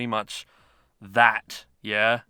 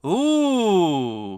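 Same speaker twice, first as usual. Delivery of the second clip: impressed ooh; 6 semitones higher, 4 dB louder than usual. Real, not acted. Reacting to the new medal.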